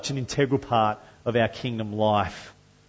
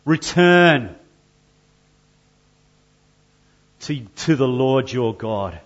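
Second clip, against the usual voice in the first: God's call; 4 dB louder than usual. Acted. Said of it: "place your name in that little gap".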